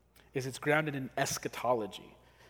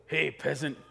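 louder, gruff bossy soldier; Roman soldier voice; 3 semitones higher, 6 dB louder than usual. Retold or imitated. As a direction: bark orders at a peasant. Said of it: "I'm gonna try to do a Roman soldier voice".

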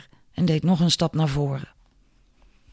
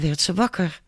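usual speaking voice

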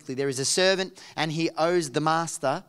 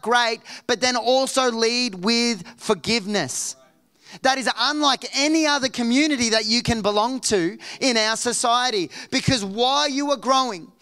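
higher, driving preacher's voice; preaching; 7 semitones higher, 5 dB louder than usual. Real, not acted. Preacher urging the community to speak truth in love, impassioned preaching. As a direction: press the point home with sustained energy.

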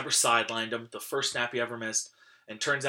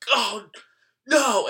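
dismayed groan; 11 semitones higher, 10 dB louder than usual. Retold or imitated. Kid's school not closed.

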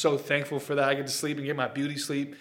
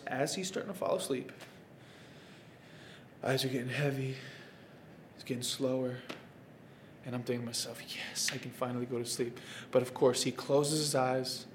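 hushed; 4 semitones lower, 10 dB quieter than usual. Retold or imitated. Dad to viewers, whispering-soft as baby nods off. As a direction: lower your voice as the baby's eyes close. Baby drifting off, quiet telling.

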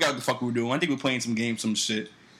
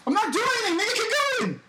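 very high, squealed denial; exasperated protest. Real, not acted. Co-host urging the storyteller on, high exasperated protest. Blamed again, protests and urges on.

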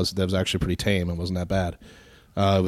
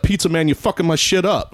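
higher, louder mock complaint; mock annoyed; 9 semitones higher, 4 dB louder than usual. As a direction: blame the pizza man. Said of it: "he's like".

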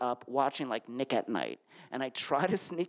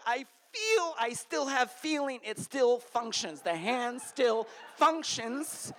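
frantic reassurance; 6 semitones higher, slower than usual. Retold or imitated. Panicked checking his hand.

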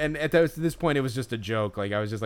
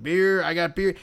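yelling; 5 semitones higher, 4 dB louder than usual. Retold or imitated. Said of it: "he's just yelling it".

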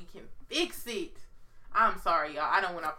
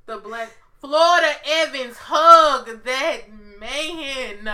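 louder, higher, very slow drawn-out cry; loud mock plea; 4 semitones higher, 11 dB louder, much slower than usual. Begging Florida Evans to hug [name], drawn-out loud cry. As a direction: stretch hug that man into a wail.

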